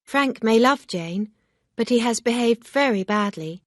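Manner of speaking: a hint of a firm young lady; Emma, lightly voiced, reproachful. A narrator's reading. Emma's voice in read story.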